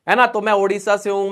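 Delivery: ordinary talk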